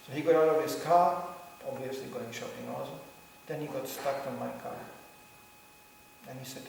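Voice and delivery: ordinary talk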